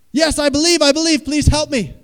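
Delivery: very high, loud desperate shout; frantic pleading. Acted. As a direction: beg frantically for rescue. Dangling man begs the voice.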